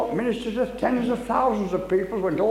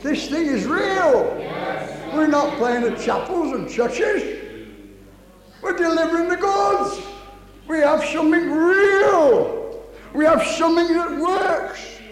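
high, raised, drawn-out declarations; forceful preaching; 7 semitones higher, 4 dB louder, slower than usual. Real, not acted. Preacher testifies to congregation it is real, forceful preaching. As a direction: declare each claim with full conviction.

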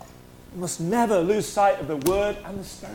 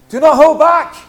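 much louder, higher call; rousing shout; 7 semitones higher, 13 dB louder than usual. Real, not acted. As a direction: drive it home to the crowd. Repeated exhortation, louder.

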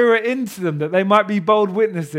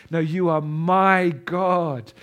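normal talking voice

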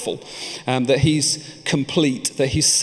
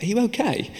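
higher-pitched concerned question; Joy; 10 semitones higher than usual. Retold or imitated. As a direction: ask it high, checking on a friend. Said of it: "and she was like".

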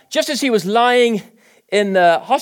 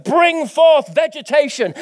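commanding God; 8 semitones higher, 4 dB louder, slower than usual. Acted.

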